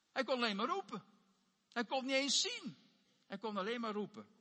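ordinary talk, nothing marked

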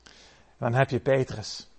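ordinary delivery

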